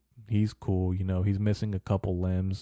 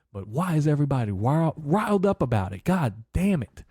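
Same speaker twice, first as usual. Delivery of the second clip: exasperated outburst; 7 semitones higher, 4 dB louder than usual. Real, not acted. Exasperated rant about riled people.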